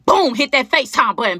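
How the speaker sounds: usual speaking voice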